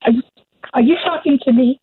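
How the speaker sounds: Robert De Niro. Real, not acted. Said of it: "you're Robert De Niro all of a sudden".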